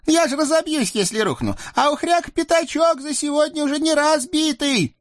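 very high squeaky devil voice, pleading; a scrawny devil begging off; whiny little devil. Acted. Тощий чёрт Наум. (писклявый чёрт)

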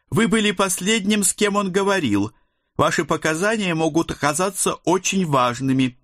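high, formal woman's voice on the phone; female caller voice. A narrator's reading. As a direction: read her as a cool official woman.

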